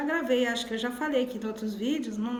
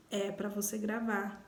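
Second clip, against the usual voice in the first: hushed and slow, much softer; soft inner voice; 5 semitones lower, 9 dB quieter, slower than usual. Retold or imitated. Quoting the Lord's quiet reply that she should record. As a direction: give it like a gentle answer heard in prayer. God's answer quoted in prayer.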